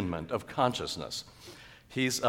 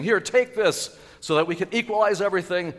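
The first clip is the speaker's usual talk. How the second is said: loud, high, urgent voice; excited, raised plea; eager offering; 10 semitones higher, 7 dB louder than usual. Acted. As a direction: press the gift on them eagerly. Acts out eager givers at church.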